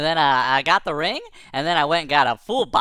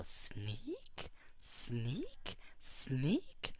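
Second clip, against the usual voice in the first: tiny hushed voice, rising each time; stealthy; 4 semitones lower, 19 dB quieter, much slower than usual. Retold or imitated. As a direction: creep along on each word, barely audible. Creeping up on the monkey.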